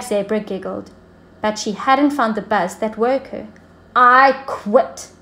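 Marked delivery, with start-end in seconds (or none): 3.9-5.1 a lightly voiced queen giving up. The Queen abandoning the puzzle, mild mock defeat. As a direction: throw up your hands and quit. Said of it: "said the Queen".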